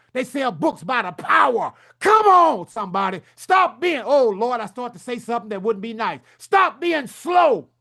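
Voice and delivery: fiery shouting sermon-style push; fired-up shout. Real, not acted. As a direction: whip the crowd up, shouting. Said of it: "let me slow down".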